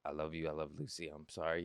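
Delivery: low, soft, consoling words; quietly retelling words of comfort; retold condolences. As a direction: offer sympathy to a grieving uncle, hushed. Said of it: "I'm saying my condolences".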